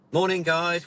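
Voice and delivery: imitating an instructor opening a briefing. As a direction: greet a room of customers, voice lifted. Retold briefing, instructor greets customers.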